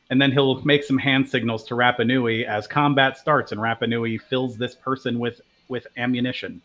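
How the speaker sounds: ordinary delivery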